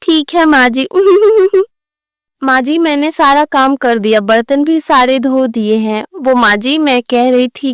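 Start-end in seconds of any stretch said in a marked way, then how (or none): 0.9-1.6 loud giggle. Acted. Daughter-in-law laughs at wrong time.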